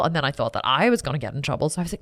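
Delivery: normal talking voice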